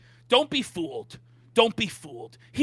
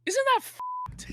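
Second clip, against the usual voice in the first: much higher, slow incredulous question; outraged disbelief. Real, not acted. Reacting to Jay's paycheck-to-paycheck wage, incredulous. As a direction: stretch it out in disbelief.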